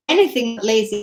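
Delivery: ordinary talk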